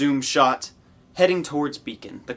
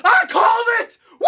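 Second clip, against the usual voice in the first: triumphant shout; 6 dB louder than usual. Real, not acted. Fan gloating over a correct guess.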